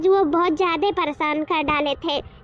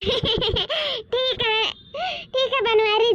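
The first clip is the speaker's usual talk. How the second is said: drawn-out, higher, laughing consent; giggling agreement; 4 semitones higher, much slower than usual. Acted. Woman giving in to brother-in-law's request, giggling. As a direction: giggle as you give in.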